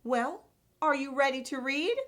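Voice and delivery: ordinary delivery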